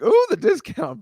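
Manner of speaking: high, drawn-out cooing "ooh"; mock delight. Telling co-host about getting the discount, mock delighted. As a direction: coo over it, drawing out the ooh.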